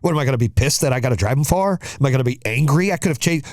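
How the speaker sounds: usual speaking voice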